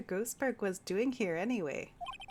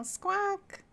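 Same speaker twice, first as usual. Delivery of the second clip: parrot squawk; 13 semitones higher than usual. Acted.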